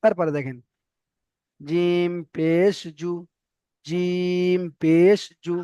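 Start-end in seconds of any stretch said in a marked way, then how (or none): 1.6-5.5 জিম পেশ জু, very slow, জু short; short জু reading. Shown as a demonstration. Teacher to students, slow spelling drill. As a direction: spell slowly, clip the জু. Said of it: "জুটা টান হলো না".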